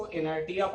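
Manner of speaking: "inertia" said with a hard t, i-ner-TI-ya; mispronounced inertia. Retold or imitated. Teacher quoting students' wrong reading, mispronounced "inertia" with hard t. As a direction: say "inertia" as e-ner-tee-ya, t not sh. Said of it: "गलत पढ़ते हैं".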